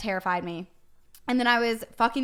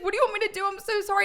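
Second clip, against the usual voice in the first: panicked guy; 10 semitones higher, much faster than usual. Retold or imitated. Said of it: "He's like".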